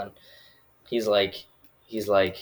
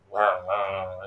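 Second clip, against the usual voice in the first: mock revving "rah"; imitated chainsaw roar; slower than usual. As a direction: growl out a pretend chainsaw chase.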